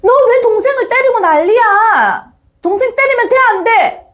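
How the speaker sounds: high-pitched acted telling-off; scolding mother. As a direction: scold a child who hit his brother. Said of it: "훈계와 지시와 명령과 나무란만".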